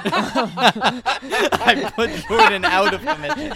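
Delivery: laughing. Real, not acted. Drunk friends laughing.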